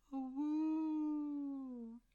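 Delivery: long high wolf howl, full voice. Acted. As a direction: throw your head back and howl. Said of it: "you now are howling".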